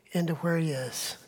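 ordinary talk, nothing marked

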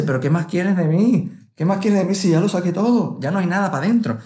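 exasperated plea. Retold or imitated. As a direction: demand what else it wants from you.

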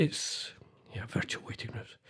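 trailing off into a whisper. Real, not acted. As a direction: drop to a breathy whisper. Mumbled aside to himself.

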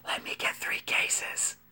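sneaky whisper; whispered aside. Retold or imitated. Ignoring his date, whispering the extra order.

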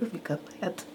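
breathy whispered mimic of the kid; laughing whisper copying the child; whispered imitation. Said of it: "You sound like [name]".

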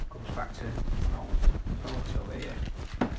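hushed whisper over a clue. Acted. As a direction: breathe it out, barely voiced.

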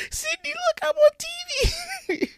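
very high squeaky quoted character; movie character voice. Retold or imitated. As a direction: pitch way up to mimic the film. Quoting Scary Movie Four character.

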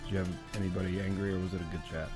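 ordinary talk

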